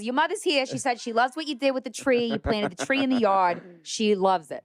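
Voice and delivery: high, hammed-up Boston accent of a psychic. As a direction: play a chatty Boston medium in a mock Boston accent. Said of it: "He has a thick Boston accent".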